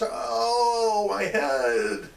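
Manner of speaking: long, high, loud wail sliding down; acted scream. Reb Feivel suddenly screams as the landlord counts, acted pained wail. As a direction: scream out in theatrical agony. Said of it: "Suddenly Reb Feivel screams".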